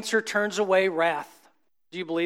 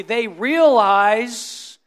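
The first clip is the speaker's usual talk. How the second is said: drawn-out emphasis; 5 dB louder, much slower than usual. Real, not acted.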